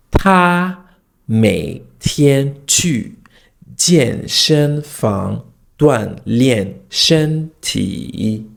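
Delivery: 他每天去健身房锻炼身体 very slowly, every tone drawn out; exaggerated tone drill. Shown as a demonstration. Teacher demonstrating tone practice with finger and head, exaggerated slow tones. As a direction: stretch each syllable of 他每天去健身房锻炼身体 along its tone contour. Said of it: "demonstrate what I'm trying to say".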